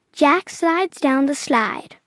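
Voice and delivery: ordinary delivery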